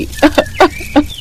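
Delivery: soft high sob; a quiet weeping sob. Acted. Reena breaking down over her mother's death, soft sob.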